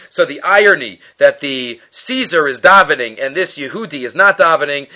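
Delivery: ordinary talk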